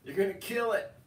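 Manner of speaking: re-enacted top-of-the-lungs yell. Owner stopping his dogs attacking a ferret, loud high-pitched yell. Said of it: "I'm yelling at the dogs at the top of my lungs".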